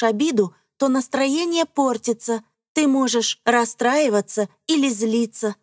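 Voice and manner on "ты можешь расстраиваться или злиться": ordinary delivery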